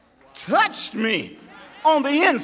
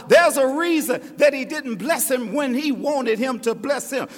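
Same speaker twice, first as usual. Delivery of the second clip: usual speaking voice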